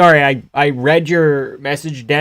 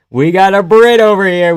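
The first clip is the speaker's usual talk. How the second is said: called out louder and higher, stress on Brit; louder repeat; 7 semitones higher, 6 dB louder than usual. Real, not acted. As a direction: announce it again, louder, leaning on Brit.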